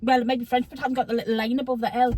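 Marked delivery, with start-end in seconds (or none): none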